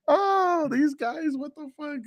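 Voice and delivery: high, laugh-strained exasperation; amused disbelief. Real, not acted. Host reacting to a leaked headline, amused high-pitched disbelief. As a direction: squeak it out, just recovering from laughing.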